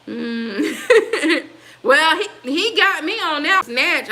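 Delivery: loud, high laugh into the words; amused laugh, caught out by the joke. Real, not acted. Laughing at an old word.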